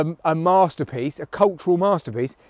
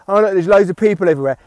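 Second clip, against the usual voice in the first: mock snob; 3 semitones higher, 8 dB louder, faster than usual. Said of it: "They're like".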